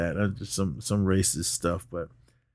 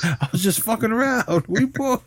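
put-on much higher, laughing we-boys; laughing buddy voice; 12 semitones higher than usual. Retelling the friends making up, high and laughing. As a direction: giggle it through as a hammy make-up.

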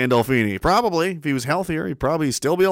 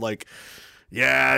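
usual speaking voice

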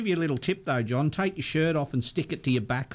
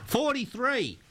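number call; 9 semitones higher, slower than usual. Real, not acted. Radio host calling draw number.

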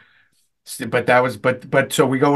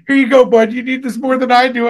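mock kindly; 8 semitones higher, 5 dB louder than usual. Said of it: "I was gonna be like".

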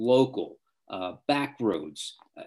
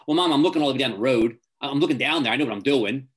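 fast, louder brush-off, know-it-all; dismissive teen; 3 semitones higher, 6 dB louder, much faster than usual. Retold or imitated. Teen brushing off mom, fast know-it-all reply. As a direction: rattle it off like a teen who knows best.

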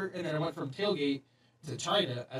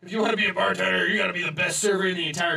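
mock gruff boss. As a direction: bark orders like a hard-nosed manager. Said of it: "He was like".

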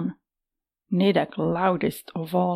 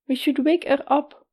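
female voice; 7 semitones higher than usual. Acted. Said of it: "a female voice".

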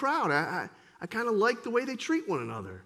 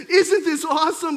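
higher, louder joyful exclaim; joyful woman; 5 semitones higher, 6 dB louder than usual. Acted. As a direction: share the good news. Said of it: "she's saying".

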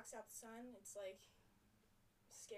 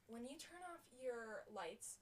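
ordinary talk

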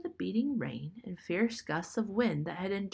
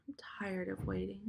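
whispered, drained of strength; exhausted whisper of a sick girl; weary whisper; 8 dB quieter than usual. Acted. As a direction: breathe it out, too tired to go on. Dying Johnsy, exhausted.

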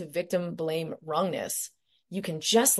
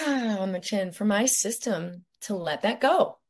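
guided meditation. Acted. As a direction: lead them gently through each point.

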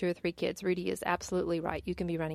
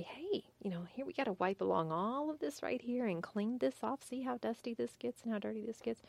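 coaxing parent; 5 semitones higher, 6 dB quieter than usual. Acted. Voicing parent showing little kids.

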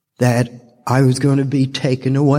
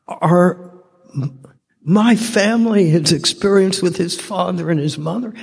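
normal talking voice

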